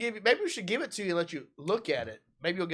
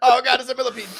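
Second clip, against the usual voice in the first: high, loud laughing mock panic; mocking through laughter; 7 semitones higher, 7 dB louder than usual. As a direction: tease him while laughing. Mocks friend's panic.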